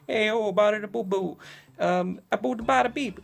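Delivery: high, loud Italian-American caricature; mock Italian. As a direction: imitate boisterous Italian patter. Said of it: "you have the Italian race".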